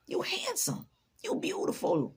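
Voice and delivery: whispery, emphatic admiration; hushed compliment. Acted. Complimenting doubting strangers, hushed and admiring. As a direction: let it drop into a whispered gush.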